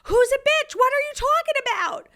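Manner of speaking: very high, loud mock-husband shout; bewildered yelling. Husband interrupting his sobbing wife's rant, bewildered yelling. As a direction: shout over the rant in confusion.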